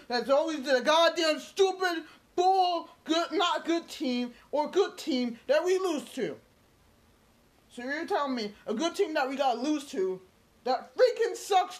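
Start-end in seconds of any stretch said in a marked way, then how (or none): 0.1-6.4 higher-pitched exasperated outburst; real fan fury; angry rant. Real, not acted. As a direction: let the frustration burst out. Angry rant about losing team.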